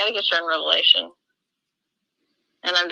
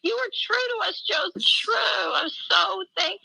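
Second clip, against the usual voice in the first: much higher, cracking with emotion; tearful praise; 13 semitones higher than usual. Real, not acted. Praising Joseph Smith to a friend, voice breaking in tears. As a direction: overcome while praising him.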